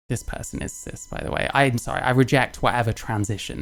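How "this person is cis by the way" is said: hushed unvoiced aside to the audience; whispered aside. Real, not acted. Streamer whispering a snide aside to viewers, hushed. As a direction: lean in and whisper it like a secret.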